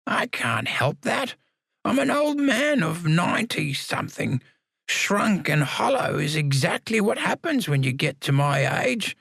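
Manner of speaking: put-on high stubborn old man; cranky old man. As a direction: grumble like an obstinate old lag. Samuel refuses, old man.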